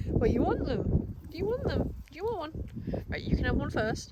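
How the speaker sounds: sing-song high voice for the dog; to Albie with a macaron, high cooing pet talk. Real, not acted.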